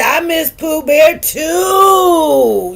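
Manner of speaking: high, loud and very slow wail; loud drawn-out wail of missing him; drawn-out cry. Retold or imitated. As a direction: stretch it out like a lament. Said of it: "She said".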